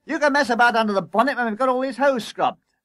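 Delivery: high, loud telling-off; bossy calling out. A narrator's reading. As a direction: shout orders at a skiving workmate. Boss calling orders to crew.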